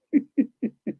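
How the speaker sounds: short real laugh, high; a quick laugh shrugging off critics; amused laugh. Real, not acted. Laughs at being called fud.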